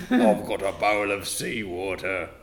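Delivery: normal talking voice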